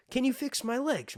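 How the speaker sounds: put-on high-pitched whine of a needy kid; whiny Bran voice. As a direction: beg like a crippled boy. Quoting Bran begging Bloodraven.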